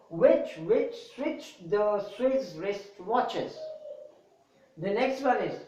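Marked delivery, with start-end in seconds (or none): 0.1-3.6 slow, careful, word by word; slow deliberate tongue twister reading; slow tongue twister demo. Shown as a demonstration. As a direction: spell out each word slowly for students. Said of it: "in a slow rhythm so that you will understand".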